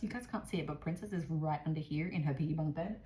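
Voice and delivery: ordinary talk, nothing marked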